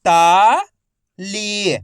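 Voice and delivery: ताली stretched slowly, each syllable held long; slow drawn-out reading. Shown as a demonstration. Teacher showing students how to read ताली, slow and drawn out. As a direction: draw out ताली slowly so children can follow each sound. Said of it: "मेरे तरीके पे गौर करना है".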